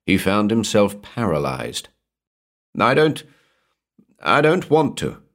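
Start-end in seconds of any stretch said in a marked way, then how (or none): 2.8-5.2 high, faltering plea with a false start; panicked refusal. A narrator's reading. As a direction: balk in fear, pitch jumping up.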